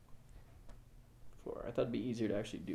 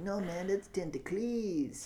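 higher, drawn-out voice of a fan correcting his neighbour; arguing fan; 7 semitones higher, slower than usual. Acted. Game master voices a fan disputing the monster's name, high arguing voice. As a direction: object slowly in a high put-on voice. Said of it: "the guy next to him's like".

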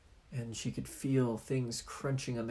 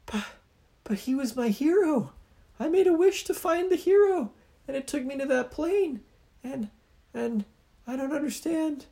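put-on upset little girl; 5 dB louder than usual.

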